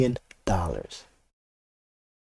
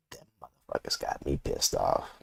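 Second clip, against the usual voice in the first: whisper; 9 semitones lower, 11 dB quieter than usual. Real, not acted. Venting about Tesla.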